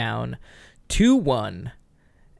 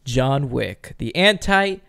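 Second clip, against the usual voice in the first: suspenseful awards presenter; 2 semitones higher, 6 dB louder than usual. Acted. Announcing the award winner.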